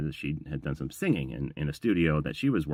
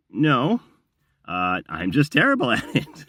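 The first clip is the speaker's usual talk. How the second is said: laughing; 7 semitones higher, 7 dB louder, slower than usual. Retold or imitated. Laughing self-deprecating reply.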